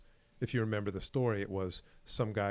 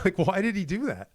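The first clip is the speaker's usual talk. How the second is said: incredulous; 10 semitones higher, 7 dB louder than usual. Real, not acted. Exasperated retelling.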